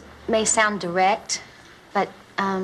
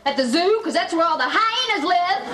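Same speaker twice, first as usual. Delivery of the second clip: higher, scornful outrage; indignant retort; 5 semitones higher than usual. Acted. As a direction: throw the insult back at a departing ex. Jilted woman hurls insult back.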